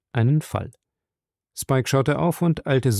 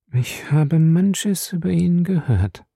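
slow, higher-pitched, measured reply; slowly; 6 semitones higher, slower than usual. A narrator's reading. Valerie admits she has heard of Bellamy, speaking slowly. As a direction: draw the words out. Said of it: "sagte sie langsam".

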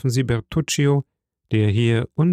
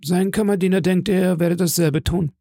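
quick, high-pitched servant reporting back; steward; 7 semitones higher than usual. A narrator's reading. Steward tells the Count about the son, high-pitched. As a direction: answer quickly in a raised steward's voice.

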